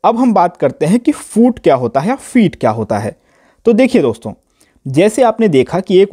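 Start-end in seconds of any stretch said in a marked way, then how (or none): none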